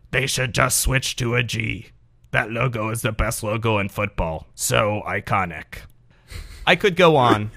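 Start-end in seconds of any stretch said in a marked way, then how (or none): 0.1-5.8 smug Packer fan. Retold or imitated. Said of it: "Here are some examples".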